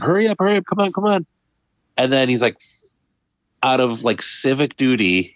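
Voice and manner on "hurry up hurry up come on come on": higher-pitched, urgent hurry up; a conductor waving a running man aboard, pitched up; urgent calling. Retold or imitated.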